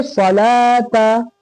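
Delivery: सलाता very drawn out, long "laa", no "w"; correct silent-waw reading. Shown as a demonstration. Teacher answering what we will read, long vowel stretched. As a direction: stretch सलाता slowly, syllable by syllable. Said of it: "तो क्या पढ़ेंगे?".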